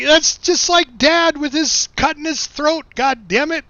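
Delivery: high, slow, half-laughing grumble; laughing exasperation. Retold or imitated. Compares brother to dad, laughing exasperation. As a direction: shake your head through it, amused.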